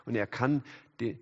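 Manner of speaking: normal talking voice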